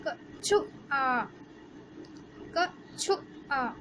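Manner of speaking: कछुआ slowly, the छु short; short u in kachhua. Shown as a demonstration. Teacher to class, slowly showing the short उ in कछुआ. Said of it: "छोटे ऊ की मात्रा की आवाज़".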